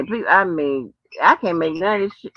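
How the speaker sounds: normal talking voice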